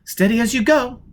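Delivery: ordinary delivery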